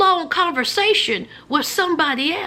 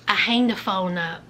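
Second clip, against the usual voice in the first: normal talking voice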